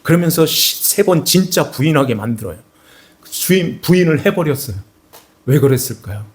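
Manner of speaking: full-force sermon climax; fervent preaching. Acted. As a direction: preach it out, building with fervor.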